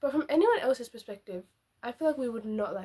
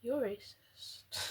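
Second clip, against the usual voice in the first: ordinary talk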